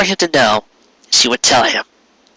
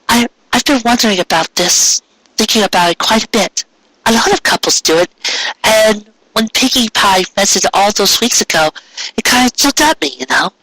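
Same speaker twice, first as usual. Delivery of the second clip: higher mare voice, a little unsure; higher voiced wife sharing an idea; hesitant wife voice; 8 semitones higher than usual. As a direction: open up about a hope shyly.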